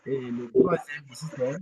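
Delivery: ordinary talk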